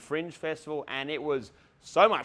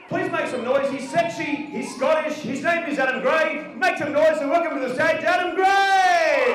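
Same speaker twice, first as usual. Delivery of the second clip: higher and louder, building to a long shouted name; MC hype intro; 12 semitones higher, 8 dB louder than usual. Real, not acted. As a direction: hype the crowd and roar the act's name. MC introducing act to crowd.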